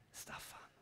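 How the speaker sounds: soft, breathy whisper of God's voice; divine whisper. Pretend. Pastor voicing God's whisper to himself, softly whispered. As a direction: whisper tenderly, close and intimate. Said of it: "så börjar det viska här inne".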